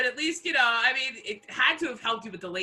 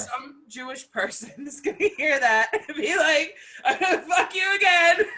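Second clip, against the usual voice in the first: laughing; 9 semitones higher, 8 dB louder than usual. Retold or imitated. Mocking an offended person, laughing.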